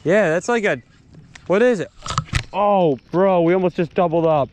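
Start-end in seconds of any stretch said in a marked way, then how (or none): none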